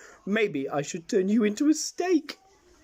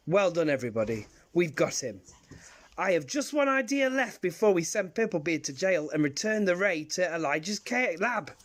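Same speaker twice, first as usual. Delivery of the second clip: ordinary talk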